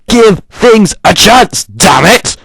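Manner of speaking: exasperated shout. Real, not acted. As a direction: yell it at the whining fans.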